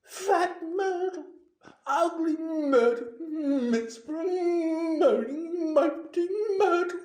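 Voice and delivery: high, loud, tearful tirade; self-pitying ghostly howling; anguished wail. A narrator's reading. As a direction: wail each insult, drawing it out. Weeping ghost wails out her nicknames.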